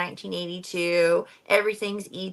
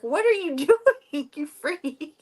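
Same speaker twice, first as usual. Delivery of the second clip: put-on startled kid; 7 semitones higher than usual. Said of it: "Abby's just sitting there on the toilet like".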